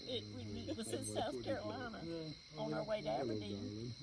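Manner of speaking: ordinary delivery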